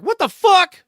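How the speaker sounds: high, loud shocked cry; shocked outburst. Retold or imitated. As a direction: blurt it like a stunned kid. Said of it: "audibly being like".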